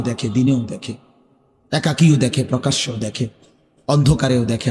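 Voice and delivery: hushed warning of God who sees all; solemn low preaching. Acted. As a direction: lay it down low and heavy. Sermon drops to solemn low.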